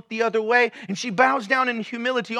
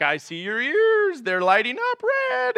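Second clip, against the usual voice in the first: slow, deliberate, rising high on the last words; a mother calmly announcing she sees through him; knowing mom; 7 semitones higher than usual. Retold or imitated. Mom reading her son's guilt.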